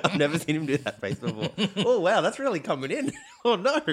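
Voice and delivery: high, breaking laughter; can barely talk for laughing; laughing hard. Real, not acted. Laughing hard at friend's face.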